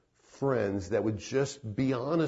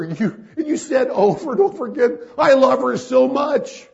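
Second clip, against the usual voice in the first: much higher and louder, building to a cry; impassioned rebuke; 10 semitones higher, 10 dB louder than usual. Retold or imitated. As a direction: preach it at the friend, rising to full force. Preacher confronts friend passionately.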